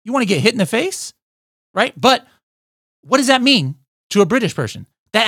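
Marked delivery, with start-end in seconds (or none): 0.0-1.1 mock offended parent. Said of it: "You might be like".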